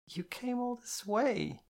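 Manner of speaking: put-on a drunk girl trying to explain through tears; tearful girl. A narrator's reading. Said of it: "I tried to explain".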